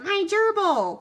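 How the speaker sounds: put-on high, loud wail of a kid over his pet; kid's dismay. Son seeing his gerbil in the soup, wailing high. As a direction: cry out in shock at the lost gerbil. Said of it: "Andy's like".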